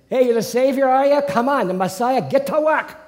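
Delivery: loud, high-pitched Brooklyn heckler; taunting Brooklyn patois, pushed way up; Brooklyn accent. Acted. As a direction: jeer like a Brooklyn tough guy. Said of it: "I give him Brooklyn patois".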